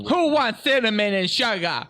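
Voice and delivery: put-on vendor accent. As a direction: hawk nuts like a stadium seller. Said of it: "that's my vendor accent".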